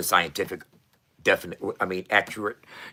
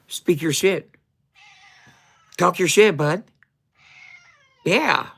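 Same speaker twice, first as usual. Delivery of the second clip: much higher, louder cooing pet voice; talking to the cat; 10 semitones higher, 6 dB louder than usual. Acted. Owner coaxing his cat on camera, high cooing pet voice. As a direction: coo at the cat like baby talk, pitched way up.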